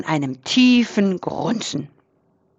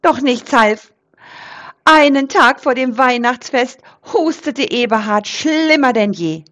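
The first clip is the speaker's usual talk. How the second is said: usual speaking voice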